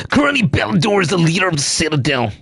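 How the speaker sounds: quick, lightly put-on voice for his companion; Manthan's line. Player voicing his companion explaining the Citadel, quick. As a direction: rattle off the fact like a know-it-all. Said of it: "Manthan says".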